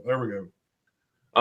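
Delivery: "There we go" said softly and slowly, drawn out. Shown as a demonstration. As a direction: ease "There we go" out slowly and quietly. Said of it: "Hold on".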